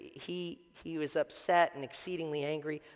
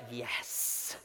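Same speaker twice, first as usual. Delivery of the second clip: whispered, much softer gloating yes; satisfied yes; 8 semitones lower, 7 dB quieter than usual. Retold or imitated. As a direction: breathe it out under your breath like the crowd. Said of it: "everybody's like".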